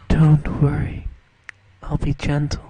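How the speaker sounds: loud, low, deadpan imitation of the actress; imitating the actress. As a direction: blurt it out like a flat movie line. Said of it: "she says it like this".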